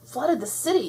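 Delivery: high-pitched alarmed call; mild yell. A narrator's reading. Blossom warning of the flood, mildly yelled. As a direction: raise the alarm without full volume. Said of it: "yelled Blossom".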